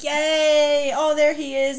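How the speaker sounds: playful little whoop of relief; mock delight. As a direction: cheer brightly as if spotting a sweetheart. Acts out Nina spotting Matthias.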